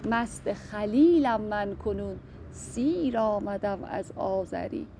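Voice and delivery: slow, measured verse reading; poem recitation. Acted. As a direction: recite the couplet slowly, each word weighed. (شعرخوانی آرام و شمرده)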